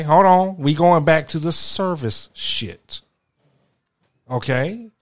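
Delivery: ordinary talk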